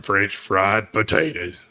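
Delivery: slow, drawn-out Southern drawl; put-on Southern accent. Riffing on a requested Kentucky accent, hammed-up Southern drawl. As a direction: drag each word out in a hammed-up Southern accent.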